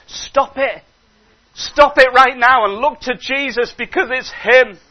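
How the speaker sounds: urgent preacher's rebuke; firm rebuke. Acted. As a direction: snap the listener out of self-pity. Preacher rebukes self-pity.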